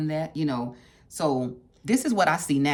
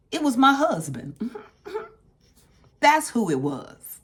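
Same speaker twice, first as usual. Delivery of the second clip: laughing through the words, higher and louder; 9 semitones higher, 4 dB louder than usual. Real, not acted. Woman laughing as she admits it was her husband. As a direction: let a real laugh spill into it.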